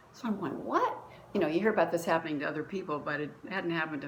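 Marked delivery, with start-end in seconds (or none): 0.6-1.0 surprised what. Retold or imitated. Startled by the inner voice.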